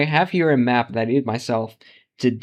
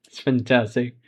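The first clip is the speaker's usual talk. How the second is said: usual speaking voice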